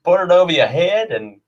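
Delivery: hammed-up Southern US air hostess safety briefing; a mock Southern US flight attendant walking through safety; Southern US accent. Air hostess briefs passengers in a hammed-up Southern US accent. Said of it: "could you imagine me going".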